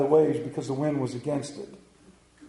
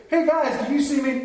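put-on higher, childlike show-off calling out; bragging like a kid on a bike, look at me; boastful Peter; 7 semitones higher than usual. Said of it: "he turns around and he goes".

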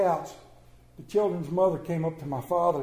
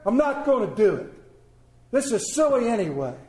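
louder, higher, exasperated complaining; indignant protest; 7 semitones higher, 5 dB louder than usual. Acted. Tired father refusing his wife's errand, loud and exasperated. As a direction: refuse it like a worn-out dad.

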